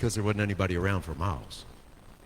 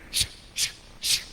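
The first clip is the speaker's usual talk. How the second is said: whispered sharp shushing; firm repeated whispered shh; stern hushing. Retold or imitated. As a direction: shush him hard like an elder silencing a youth. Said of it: "he did it again".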